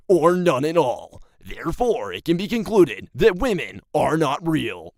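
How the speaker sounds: loud, swooping conspiracy theorist; stupid conspiracy voice. Acted. Conspiracy theorist proving women are not real, silly conspiracy voice. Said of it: "that stupid-ass, like, conspiracy voice".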